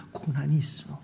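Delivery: whispered gentle voice of God; passing whisper. Retold or imitated. God whispering to the weeping pastor, hushed. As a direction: breathe it out like a voice passing by. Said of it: "지나가는 음성처럼 들려주신".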